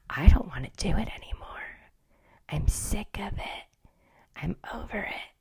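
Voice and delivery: fed-up whisper, trailing off; weary whisper. Real, not acted. To viewers, fed up with new apps, whispered. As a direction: sigh it out, sick of it all.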